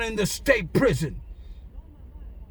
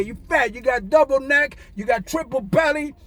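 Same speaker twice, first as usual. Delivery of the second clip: ordinary talk, nothing marked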